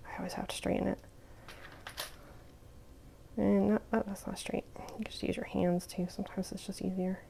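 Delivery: whispering under her breath. Real, not acted. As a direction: mutter it softly to yourself. Muttering while she works.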